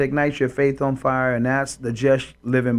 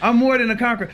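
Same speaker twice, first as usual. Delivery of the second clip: excited declaration; 8 semitones higher, much faster than usual. Real, not acted. Repeats declaration, lifted.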